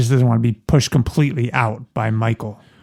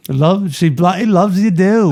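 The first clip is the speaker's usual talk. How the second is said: higher, laughing British put-on; British accent; 6 semitones higher than usual. Cohost mocks the host's British-sounding impressions. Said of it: "It sounds like a British".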